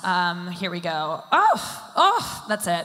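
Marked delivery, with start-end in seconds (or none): 1.3-1.9 a sudden high, loud gasping oh; Jennifer Aniston impression. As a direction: gasp out a high oh. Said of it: "I can do a Jennifer Aniston impression".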